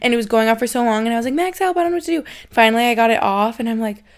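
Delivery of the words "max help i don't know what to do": mock plea for help. Calling boyfriend for help.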